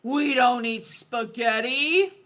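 slow, growly lion voice; growling lion. A narrator's reading. Lion scolding the pupil over the menu, slow growl. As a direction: growl it out slowly, like an offended lion. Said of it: "The lion growled".

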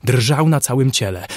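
plain reading voice

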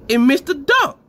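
high, loud teasing yell; incredulous mocking shout. Real, not acted. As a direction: rib him loudly for the miss. Judge mocking missed dunk.

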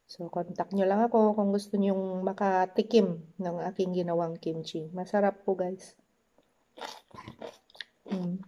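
talking while chewing; biting food mid-sentence; mouth full. Real, not acted. Seen 1: Holds fried piece at lips, bites and chews while talking.

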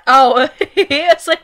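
ordinary talk, nothing marked

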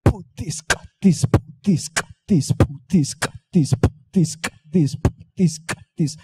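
"put this, cut this" looped as a steady drum beat; beatbox loop. Shown as a demonstration. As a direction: keep "put this, cut this" percussive and strictly rhythmic. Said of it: "Now do it with me".

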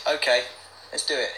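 ordinary talk

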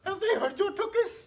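high, fawning servant voice; doting servant. Acted. Servant dressing her prince, high and fawning. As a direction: gush over the prince in a high voice.

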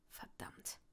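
breathless whispered oath; whispered curse. Acted. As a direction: curse under your breath. Draco curses to himself.

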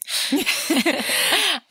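a bright high burst of laughter; delighted laugh. Real, not acted. Reader laughing at her favorite pirate line.

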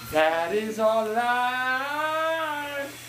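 slow, drawn-out sigh of a line; very slow drawl. Shown as a demonstration. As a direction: stretch every word out slowly. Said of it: "And that is our life".

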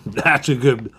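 speaking between bites; talking while chewing. Real, not acted. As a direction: mouth full of pretzel. Seen 0: Bearded man bites and chews pretzel sticks while talking.

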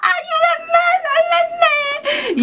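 very high, wailing plea; sister's hammed-up crying plea for help; mock cry for help. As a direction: cry out like the sister under the chainsaw. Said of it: "Y mi hermana".

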